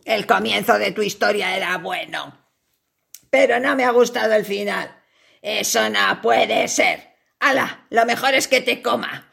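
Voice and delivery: low, menacing spider voice; a big hungry spider toying with its prey. Acted. Spider speaks to fly.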